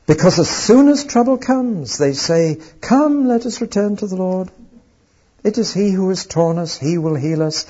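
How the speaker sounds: mocking, high and loud, sneering at their easy repentance; a preacher mocking a shallow repentance, sarcastic. Shown as a demonstration. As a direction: read it with heavy sarcasm. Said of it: "I've read that in a sarcastic tone of voice".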